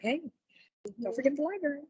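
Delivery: ordinary delivery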